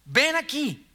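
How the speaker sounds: a scolding yell to a fisherman; mock shouting from the shore. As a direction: call him like an impatient shout from the shore. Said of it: "en la orilla gritándote".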